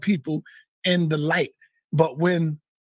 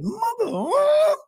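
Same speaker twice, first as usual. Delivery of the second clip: high outraged cry; shouted outrage; slower than usual. Retold or imitated. After the friend screams at him, a high outraged shout. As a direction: explode back at him in disbelief. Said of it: "He started screaming off on me".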